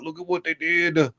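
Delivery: put-on high, hushed gullible old person; mocking old viewer. Mocking old folks fooled by the news. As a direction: gawk like a fooled grandparent. Old people watching CNN.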